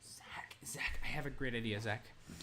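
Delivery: hushed conspiratorial whisper. Real, not acted. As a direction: whisper the idea to a friend. Secret idea to partner.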